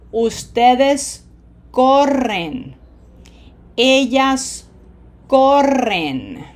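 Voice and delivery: slow, loud conjugation drill with long rolled R in "corren"; drill enunciation. Shown as a demonstration. Spanish teacher modelling verb forms for learners, slow and loud. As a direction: stretch each word out forcefully, rolling the R in "corres", "corremos".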